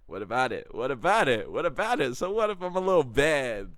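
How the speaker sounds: from normal to high, loud challenge; building defiance. Acted. Man answering those who call him evil, increasingly defiant.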